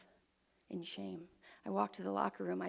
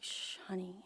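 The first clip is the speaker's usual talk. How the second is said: soft whispered comfort; soothing hush; 5 dB quieter than usual. Retold or imitated. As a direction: hush her like a father calming a child. Father comforting her.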